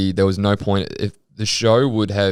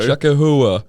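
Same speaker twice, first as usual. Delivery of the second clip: Chacahua in a loud New Jersey accent; 6 semitones higher, 4 dB louder, slower than usual. Shown as a demonstration. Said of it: "But in, like, a Jersey accent".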